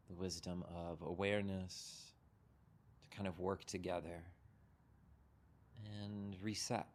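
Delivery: unhurried meditation guide, long pauses between phrases; guided meditation. Real, not acted. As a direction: guide them gently into settling, leaving room to breathe.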